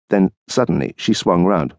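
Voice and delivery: ordinary delivery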